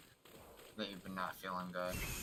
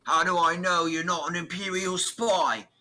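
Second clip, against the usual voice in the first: loud, high, hammed-up Peaky Blinders accent; over-the-top, raised Peaky Blinders accent; 10 semitones higher, 15 dB louder, slower than usual. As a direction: challenge them in a mock Peaky Blinders accent. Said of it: "Peaky Blinders".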